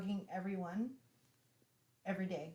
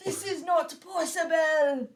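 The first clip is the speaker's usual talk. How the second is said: slow, loud, much higher mock celebrity chef in a French accent; Gordon Ramsay impression; 7 semitones higher, 10 dB louder, slower than usual. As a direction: ham up a bad Gordon Ramsay, drawn out and loud. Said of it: "Worst Gordon Ramsay impression ever".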